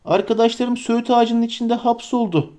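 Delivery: high, urgent plea; breathless cry. Acted. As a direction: blurt it out of breath. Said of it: "diye bağırdı Frodo nefes nefese".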